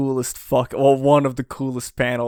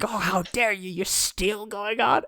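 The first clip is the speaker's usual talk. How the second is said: imitating an indignant scream at half volume; mock scream; 8 semitones higher, 4 dB quieter than usual. Big Mom scolding the boy, high mock scream. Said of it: "Big Mom is screaming at him".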